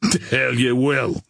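tough, defiant sneer at ordinary volume. A narrator's reading. Billy Byrne defying the angry officer, contained sneer. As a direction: sneer it back at him. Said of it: "sneered Billy Byrne".

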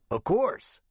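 high, raspy veteran agreeing; rough, rasping captain. A narrator's reading. Retired captain agrees to meet the investigator, high rasping voice. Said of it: "He had a rough, rasping voice".

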